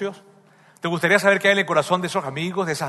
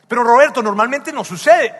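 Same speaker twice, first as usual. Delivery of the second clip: higher and louder protest, a touch mocking; objecting listener; 7 semitones higher, 12 dB louder than usual. Imagined church member protesting to Roberto, loud mock objection. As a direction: blurt out the objection.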